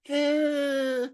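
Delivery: high, drawn-out "ehh"; long squeaky noise of comic doubt; doubtful whine. Shown as a demonstration. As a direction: hold a high, thin wavering "eh". Comic doubt about riding horses.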